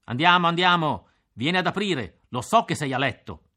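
loud impatient shout at the door; shouting through the door. A narrator's reading. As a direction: bellow it through a closed door. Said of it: "gridò attraverso la porta".

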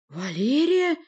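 high, loud incredulous question; clamouring astonishment. A narrator's reading. Boyars react to the sorceress's claim, clamouring. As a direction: exclaim the name in disbelief. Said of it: "загалдели бояре".

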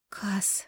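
soft moaned name; moaning. Acted. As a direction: moan the name quietly. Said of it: "выгнувшись, простонала Даша".

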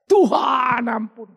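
crying for mercy. Retold or imitated. David begging God for mercy after the prophet's rebuke, slow weeping plea. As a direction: drag it out, weeping for forgiveness. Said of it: "baru dia nangis teriak".

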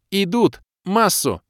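high, loud urgent cry; alarmed shout. A narrator's reading. Kira warning the party, high alarmed shout. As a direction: call out the danger.

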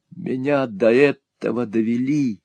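ordinary delivery

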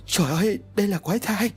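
frightened cry. A narrator's reading. Midwife crying out in horror at the deformed newborn, high and frightened. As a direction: recoil in terror at the monster baby. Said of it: "Bà đỡ sợ hãi rồi nói".

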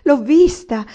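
loud, high, drawn-out repeated cry; desperate cry. A narrator's reading. Anania insists he has seen her, desperate. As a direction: wail it out forcefully. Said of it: "gridò".

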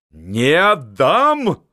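very slow, loud, high defiant roar; old marshal refusing in a rage; furious. A narrator's reading. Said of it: "страшен в гневе". (в гневе, громко, медленно)

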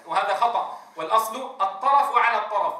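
ordinary talk, nothing marked

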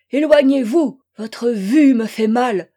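loud, anguished repulsion; distraught cry. A narrator's reading. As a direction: cry out as if the sight hurts. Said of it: "lui cria-t-elle, dans un état impossible à décrire".

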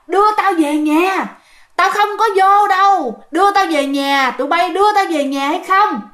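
imitated insistent hollering; retold yelling. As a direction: demand it like an angry spirit, without a full scream. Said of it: "nó hét, nó chửi".

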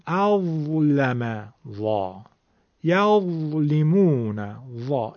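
اظلم and یظلمون slow, the ظ rough from the tongue tip; tongue-tip ظ demo. Shown as a demonstration. Teacher isolates the rough ظ sound slowly for students. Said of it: "این نوک زبونی بودن".

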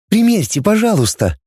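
put-on high sweet robot girl; cartoon princess. A narrator's reading. Robot saleswoman's voice continued.

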